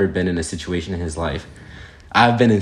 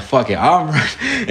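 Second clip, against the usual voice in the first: laughing panic; 8 semitones higher than usual. Retold or imitated. Panicked decision to flee.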